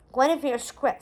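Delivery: ordinary delivery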